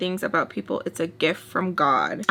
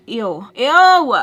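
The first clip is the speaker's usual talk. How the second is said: loud, higher mock-disgusted ew; retold disgust; 4 semitones higher, 9 dB louder than usual. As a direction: recoil with an exaggerated, louder ew. Retold disgust at the man's refusal.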